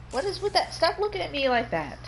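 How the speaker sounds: high pitched, baffled protest; surprised. Real, not acted. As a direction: squeak out the question. Reacts to partner's face.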